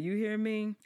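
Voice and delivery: usual speaking voice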